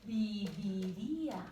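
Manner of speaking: viviría said syllable by syllable, very slowly; slow dictation. Shown as a demonstration. Teacher dictates the tense to students, very slow. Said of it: "Y en pospretérito yo".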